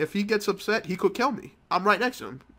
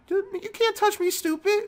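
very high squeaky mock voice taunting. Gamer taunting his opponent in a squeaky voice. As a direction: sneer it in a tiny high voice. Mocking voice during game taunt.

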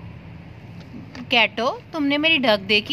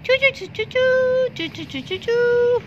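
very high chirping; bird chirps; 10 semitones higher, slower than usual. Acted. Little bird calls Barbie for help, very high chirps.

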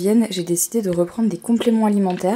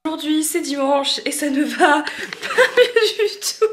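laughing; 7 semitones higher, 4 dB louder, slower than usual. Real, not acted. Laughing at her pain.

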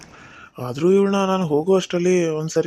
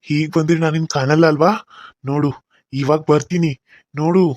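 ordinary talk

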